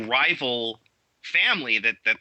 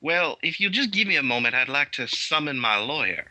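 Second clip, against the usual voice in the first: put-on a sweetly polite Southern belle accent; 3 semitones higher than usual. Player to the GM, in a mock Southern accent. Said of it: "in her best Southern accent".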